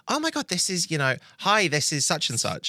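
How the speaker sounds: mock gushing, starts very high then settles; gushing intro. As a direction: overeager hello to fill the silence. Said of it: "I'll be like".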